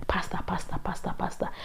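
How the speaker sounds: "pasta" whispered, "pastor" with no r; whispered Ghanaian "pastor". Retold or imitated. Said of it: "they used to say pasta".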